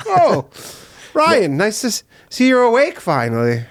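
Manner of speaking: high, sugary-sweet mock greeting; snide passive-aggressive Siri. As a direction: greet him with syrupy spite. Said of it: "mock Siri greeting".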